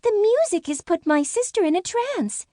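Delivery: high fairy voice, anxious; a small fairy alarmed for her sister; worried fairy. Acted. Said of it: "Rainbow Frost".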